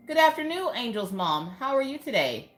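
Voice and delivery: high lilting hello; sing-song greeting. Pretend. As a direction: greet the viewer brightly and high.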